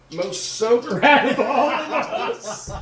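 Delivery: slow, high, breaking into laughter; laughing. Real, not acted. Driver admitting being high too, laughing while talking. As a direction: laugh through the punchline.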